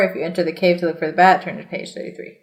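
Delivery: ordinary talk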